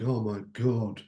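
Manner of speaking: mock-groggy groan, drops off quiet; a sleepy grumbler hating the alarm; groggy moaning. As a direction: moan like you just dragged yourself awake. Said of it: "you can wake up this morning and you can think".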